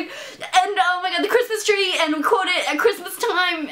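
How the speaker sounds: breathless excited fangirling; gushing fangirl. Retold or imitated. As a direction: gush like a starstruck fan. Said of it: "such a weirdo".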